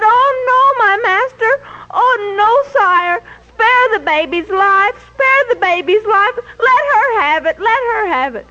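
high, sobbing plea; weeping mother. A narrator's reading. Real mother weeping at King Solomon's feet, sobbing plea. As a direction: beg through tears to spare the child. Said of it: "crying, weeping at the feet".

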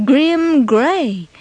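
usual speaking voice

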